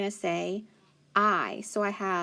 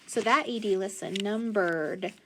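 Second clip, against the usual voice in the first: normal talking voice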